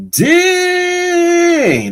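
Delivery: long, loud, high-pitched exclamation; real high-pitched holler of amazement; amazed outburst. Real, not acted. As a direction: stretch it out in a high, amazed shout. Reacting in amazement to chat.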